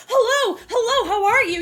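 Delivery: high, loud, overeager hello; awkward greeting. Retold or imitated. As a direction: overdo an awkward first greeting. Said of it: "it was a very awkward conversation".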